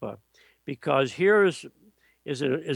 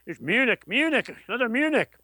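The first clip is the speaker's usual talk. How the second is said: imitating a German-accented shout, rising high; mock German accent, climbing in pitch; a hint of a German accent, pitched up high; 8 semitones higher than usual. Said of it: "came running down the aisle in German accent".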